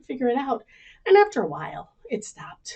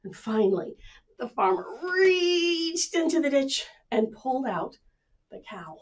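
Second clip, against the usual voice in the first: plain reading voice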